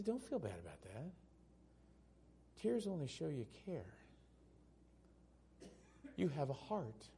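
soft, reassuring words; gentle comfort. Retold or imitated. Pastor comforting a weeping mourner, softly. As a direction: comfort a grieving friend quietly.

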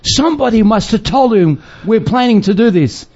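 high-pitched mock alarm; alarmed villagers. Witch doctors to each other on being discovered, mock alarm. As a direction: act out startled plotters caught in the act. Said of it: "and they go".

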